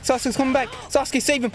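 ordinary talk

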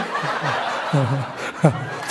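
low chuckling laughter; laughing. Real, not acted. Comedian laughing after the grandpa joke, low chuckle. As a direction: crack up at the punchline.

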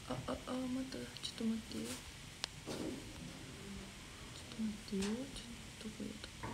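soft, half-whispered searching mutter; barely voiced, talking to herself while searching; muttering under breath. Real, not acted. Idol scrolling for a comment, muttering softly to herself.

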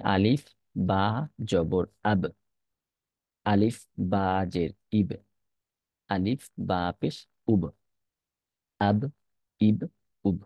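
slow, measured spelling drill; teacher's deliberate recitation for practice; letter-by-letter drill. Shown as a demonstration. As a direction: spell each letter separately, then give the joined syllable. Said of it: "প্রত্যেকটা হরফকে আলাদা করে".

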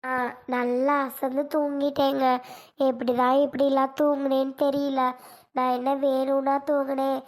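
slow, subdued, apologetic voice; meek excuse. Acted. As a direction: explain yourself meekly after being scolded. Just-woken wife meekly excusing herself. (தாழ்ந்த குரலில் தயங்கி மன்னிப்புக் கேட்பது போல)

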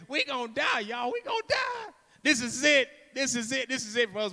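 high, wailing mock terror; hammed-up doomed wail; panicking Peter. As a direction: cry out in comic panic, voice shooting up. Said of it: "Can y'all imagine Peter?".

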